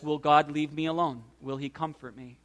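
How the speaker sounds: low, soft question of sorrow; understated despair. Acted. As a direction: voice sorrow quietly, fading very soft at the end. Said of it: "Despair, sorrow says".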